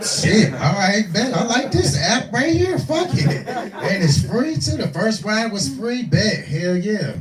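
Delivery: high, excited rush of approval; delighted. Retold or imitated. Rider discovers a free-ride app, delighted and high-pitched.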